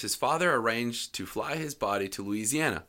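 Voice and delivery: ordinary talk, nothing marked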